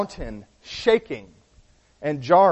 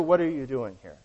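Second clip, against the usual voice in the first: preacher softly voicing God, lower; still small voice; 4 semitones lower, 6 dB quieter than usual. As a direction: ask it quietly, voice dropped low. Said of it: "God speaks to Elijah in the still small voice".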